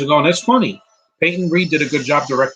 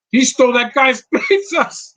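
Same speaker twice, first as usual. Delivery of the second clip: laughing; 12 semitones higher, 5 dB louder than usual. Real, not acted. Amused at scene.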